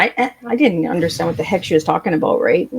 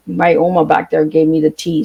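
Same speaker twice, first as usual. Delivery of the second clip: usual speaking voice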